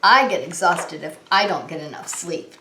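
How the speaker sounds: plain reading voice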